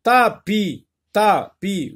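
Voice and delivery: "Tapis" high, split "ta... pi"; teacher sounding out "Tapis" by syllable; repeated syllable drill. Shown as a demonstration. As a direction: say "Tapis" twice in separate beats, t clearly sounded. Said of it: "Répète plusieurs fois ce mot".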